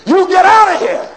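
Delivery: high, loud angry shout; angry refusal. Acted. Prisoner yelling at the governor to get out. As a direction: throw him out with a yell.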